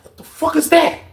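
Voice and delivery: high startled what; retelling a startled cellmate. Retold or imitated. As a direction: jump at a strange noise.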